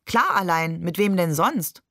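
usual speaking voice

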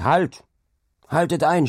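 high, loud order to stop; shouted command. A narrator's reading. Hauke stops the carts burying the dog, shouted command. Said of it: "schrie er zu den Karren hinunter".

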